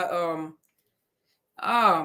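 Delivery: ordinary talk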